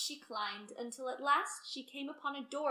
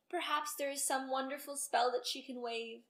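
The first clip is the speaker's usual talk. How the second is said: ordinary delivery, nothing marked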